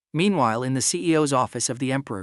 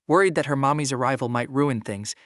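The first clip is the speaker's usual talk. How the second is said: plain reading voice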